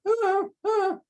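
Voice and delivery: very high little yelping whine; imitated dog whine. Breeder imitating a noisy dog's little yelps. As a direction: squeak it out like a vocal dog. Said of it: "make a little bit of noises like".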